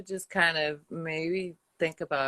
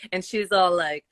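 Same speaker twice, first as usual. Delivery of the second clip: usual speaking voice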